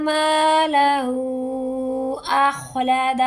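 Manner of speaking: slow melodic tilawat; Quran recitation. Shown as a demonstration. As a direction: recite with drawn-out vowels. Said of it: "recitation demo in tajweed lesson". (قرآن کی تلاوت کا انداز)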